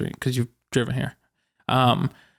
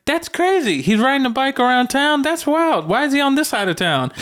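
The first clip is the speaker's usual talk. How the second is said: very high-pitched, wide-eyed wonder; imitated amazed partner; 12 semitones higher than usual. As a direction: mimic an oblivious partner in a high voice. Partner's amazed remarks, retold.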